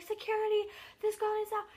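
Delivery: high-pitched imitation of people calling out; mock urgent call, pitched well up; calling for help. Crowd calling security over to a girl, urgent retold call.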